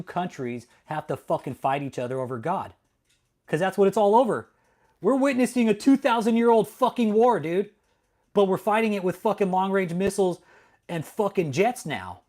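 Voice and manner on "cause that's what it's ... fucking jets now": louder, higher ranting; ranting at chatter Craig about war; heated rant. Real, not acted. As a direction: rail against it all.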